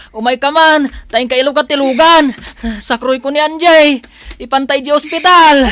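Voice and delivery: urgent shout, high and loud; calling for help. Acted. Son shouting to Manang Elisa for help, urgent. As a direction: yell across to the neighbor for help.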